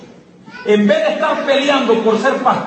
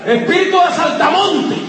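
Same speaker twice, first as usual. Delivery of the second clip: excited mocking; 7 semitones higher than usual. Real, not acted. Preacher mocks church-hoppers.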